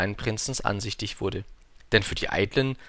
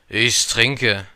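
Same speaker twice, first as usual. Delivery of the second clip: put-on loud, gloomy drunkard's answer; gloomy drinker; 7 dB louder than usual. A narrator's reading. Drinker answers the little prince, gloomy and loud. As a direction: state it glumly but heavily. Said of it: "mit düsterer Miene".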